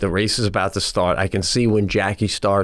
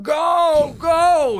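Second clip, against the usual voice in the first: cheering scream. Retold or imitated. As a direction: scream to push the runner on. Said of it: "Jackie screaming".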